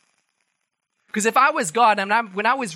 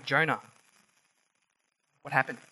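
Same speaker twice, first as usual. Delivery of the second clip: softer, lower, kindly asking; gentle question; 4 semitones lower, 6 dB quieter, faster than usual. Retold or imitated. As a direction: ask him tenderly like a patient father. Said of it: "asks Jonah".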